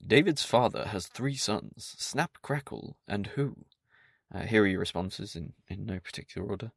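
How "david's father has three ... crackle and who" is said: slow, drawn-out quiz host voice. Acted. Host reading the quiz question to listeners in slow quiz voice. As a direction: pose it like a quizmaster, slowly. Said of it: "I should do my quiz voice".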